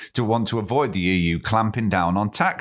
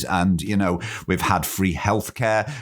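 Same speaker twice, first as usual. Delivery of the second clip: ordinary delivery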